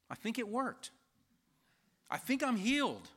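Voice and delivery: high-pitched, dawning amazement; amazed realization. Retold or imitated. Sick woman realizing she is healed, high and amazed. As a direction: realize it aloud, pitch climbing on the last word.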